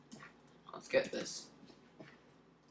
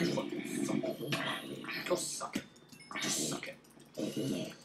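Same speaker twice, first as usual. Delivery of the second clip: slow whispered insult; muttered insults; 4 semitones lower, 6 dB quieter, much slower than usual. Real, not acted. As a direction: spit it under your breath.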